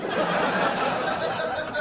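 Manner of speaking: loud breathy burst of laughter; big laugh. Real, not acted. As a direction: crack up out loud. Audience joke lands, big laugh.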